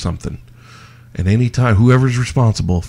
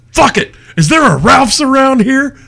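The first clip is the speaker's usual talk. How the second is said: high-pitched acted exasperation; exasperated outburst; 13 semitones higher, 3 dB louder than usual. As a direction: play a customer giving up in a huff. Acts out fed-up mourner in film.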